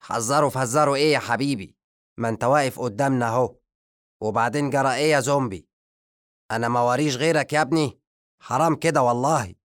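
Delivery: fast, high, exasperated scolding; a wizard snapping at an unwelcome guest; irritated wizard. A narrator's reading. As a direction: rattle off the complaint, fed up. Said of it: "بغضب".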